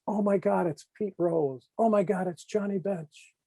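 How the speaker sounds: high, awed fan gasp; imitating a starstruck Kissinger; mock awestruck fan voice; starstruck gushing. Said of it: "he looks at Pete Rose and he goes".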